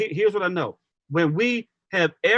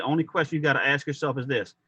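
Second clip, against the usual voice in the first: usual speaking voice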